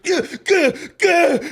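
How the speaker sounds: mocking grunts. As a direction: imitate him slamming the bottle with silly grunts. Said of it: "He's like".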